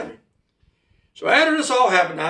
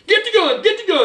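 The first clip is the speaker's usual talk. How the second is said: high, frantic acted shriek; reenacted high-pitched urgent order; panicked cry; 12 semitones higher, 3 dB louder than usual. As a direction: raise the alarm in a panic. Retold panic bursting into the bar.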